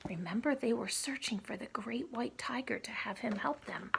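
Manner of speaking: ordinary delivery, nothing marked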